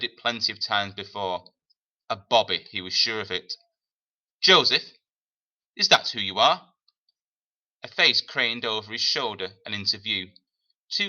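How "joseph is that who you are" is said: a lightly voiced tough older woman, raised and questioning; a hint of a hard, lived-in woman's voice; gruff woman. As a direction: call him like a no-nonsense older woman. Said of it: "a deep, gravelly voice".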